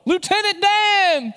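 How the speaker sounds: very high, drawn-out excited holler; Forrest Gump. Acted. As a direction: yell a long, delighted greeting from a boat. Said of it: "just like Forrest Gump jumping off the boat".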